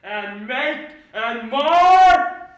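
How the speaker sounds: slow, booming Ercles rant; lofty ranting tyrant. Acted. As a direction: declaim it like a raging tyrant. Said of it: "oh, this was lofty".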